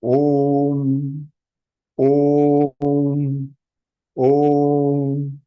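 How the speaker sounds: Om moderately long, level "ooo" into hummed "mm"; right Om pronunciation. Shown as a demonstration. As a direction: intone Om evenly without overstretching it. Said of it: "The right pronunciation of the Om".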